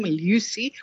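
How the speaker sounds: "Lucy" said as Lyoo-see; Lucy with yod. Shown as a demonstration. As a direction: put a y-glide after the L in "Lucy". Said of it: "Lucy with a y".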